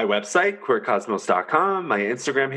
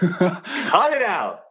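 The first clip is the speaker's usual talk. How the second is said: higher, very slow, drawn out; mock scolding; 8 semitones higher, much slower than usual. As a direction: drag it out teasingly.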